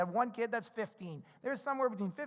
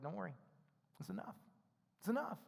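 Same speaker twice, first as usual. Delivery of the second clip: much softer, calm and unhurried; gentle reassurance; 11 dB quieter, faster than usual. Retold or imitated. Jesus softly reassuring worried disciples. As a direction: soothe them, nothing to fear.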